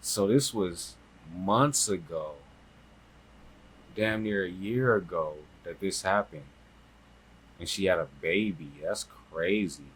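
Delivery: soft, slowed-down disbelief; stunned. Real, not acted. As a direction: let it sink in quietly. Letting the baby news sink in.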